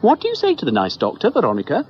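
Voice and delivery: high, fast sing-song prompt; mother prompting her daughter to be polite. Acted. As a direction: nudge the child to say thanks. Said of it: "asked Prunella".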